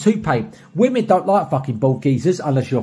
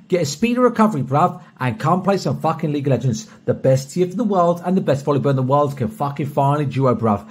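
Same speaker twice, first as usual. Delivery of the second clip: normal talking voice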